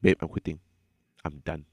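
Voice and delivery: soft and low, fading out; flat quiet resignation. Retold or imitated. Telling his girlfriend he quits, quiet and drained. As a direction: say it drained, nothing left. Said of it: "I just looked at her and went".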